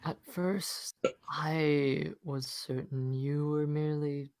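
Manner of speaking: slow whispery eerie voice; spooky voice. Acted. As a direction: creep through every word slowly. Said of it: "Back to spooky voice".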